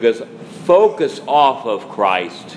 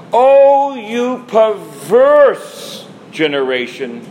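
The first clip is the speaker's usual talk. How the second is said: great exasperation; 6 semitones higher, much slower than usual. Retold or imitated. The Lord rebuking a faithless crowd, exasperated and slow. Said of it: "with great exasperation".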